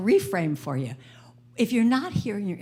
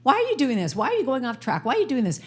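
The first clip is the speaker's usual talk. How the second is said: berating inner critic; 5 semitones higher, faster than usual. Retold or imitated. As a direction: scold yourself like an exasperated critic. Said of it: "We go".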